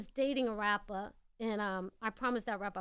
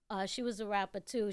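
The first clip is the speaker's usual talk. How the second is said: ordinary delivery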